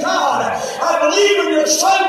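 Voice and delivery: high, loud, driving revival shout; fervent testimony preaching. Real, not acted. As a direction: preach it at full force. Testifies at full force.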